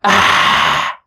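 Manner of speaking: a mock breathy ah from a burn; mock burn yelp. Shown as a demonstration. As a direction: yelp as if touching something hot.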